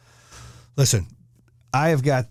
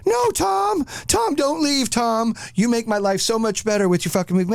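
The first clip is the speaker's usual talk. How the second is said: squeaky falsetto whine of a begging fan, much higher; mock pleading fan; 10 semitones higher than usual. Host mocking fans begging Tom Hanks to stay, falsetto whine. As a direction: beg Tom not to leave in a silly high voice. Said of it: "your fans are gonna be like".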